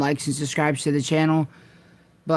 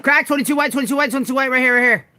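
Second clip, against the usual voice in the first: urgent callout; 7 semitones higher, 4 dB louder, much faster than usual. Real, not acted. Callout to team.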